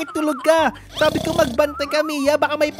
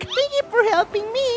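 rescued villager voice; 9 semitones higher than usual. Pretend. Rescued character thanks the player.

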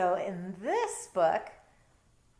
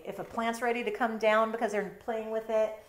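ordinary delivery

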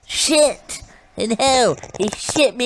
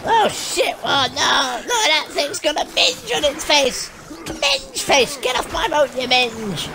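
panicked shouting; 5 semitones higher than usual. Real, not acted. Player attacked by a swamp monster, panicked shouting. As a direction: shriek in panic at the creature.

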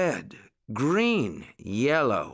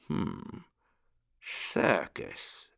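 murmured; 6 dB quieter, much slower than usual. A narrator's reading. Old rabbit gentleman considers the circus, thoughtful soft murmur. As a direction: think aloud softly, barely voiced. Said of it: "murmured the old rabbit gentleman".

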